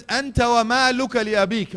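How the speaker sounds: loud, high, ringing proclamation; forceful declaration. Acted. Preacher declaiming hadith.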